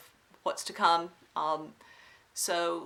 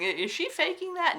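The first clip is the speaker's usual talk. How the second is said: much higher mock-questioning voice; mock wondering viewer; 11 semitones higher, 4 dB louder than usual. Vlogger voicing viewers who doubt her Southern accent, mock wondering. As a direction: pipe up high as a doubting viewer. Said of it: "For those of you who are wondering".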